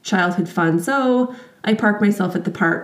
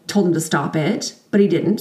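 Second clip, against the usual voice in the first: normal talking voice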